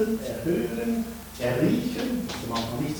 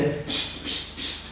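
unvoiced repeated shush; 6 dB quieter, much slower than usual. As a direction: hiss psst to silence. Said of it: "hörte Jesus auch diese".

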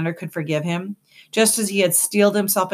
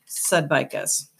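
ordinary delivery

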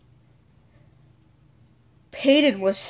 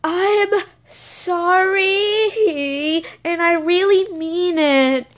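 slow, higher, timid apology; trembly voice; 6 semitones higher, much slower than usual. Acted. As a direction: apologize slowly like a scared kid. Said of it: "he said in a trembly voice".

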